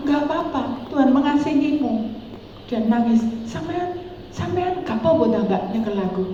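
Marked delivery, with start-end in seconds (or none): 0.1-2.1 gentle reassurance. Retold or imitated. Reassuring the crying woman. 3.4-6.2 timid disbelief. Retold or imitated. Sick woman shocked at being touched.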